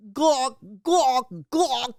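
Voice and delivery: high, squawking raptor call. Retold or imitated. Imitating the raptors calling in the film, high squawk. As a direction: screech like the Jurassic Park raptors. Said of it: "raptors, when they're, like, calling".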